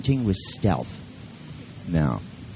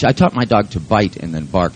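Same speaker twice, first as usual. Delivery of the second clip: ordinary talk, nothing marked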